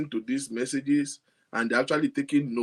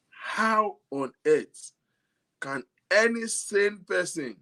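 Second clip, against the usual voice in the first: slow, drawn-out disbelief rising higher; slowly, can anyone really listen; 5 semitones higher, slower than usual. Real, not acted. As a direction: stretch each word in disbelief.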